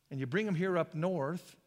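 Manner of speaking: ordinary delivery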